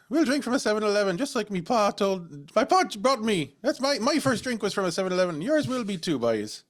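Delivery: high, hammed-up Irish accent; lilting high Irish impression; Irish tour guide. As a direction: play a mock-Irish guide reminiscing proudly. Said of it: "He's this Irish guy".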